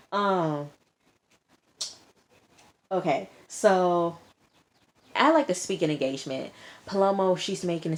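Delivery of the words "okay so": usual speaking voice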